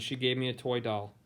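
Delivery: plain reading voice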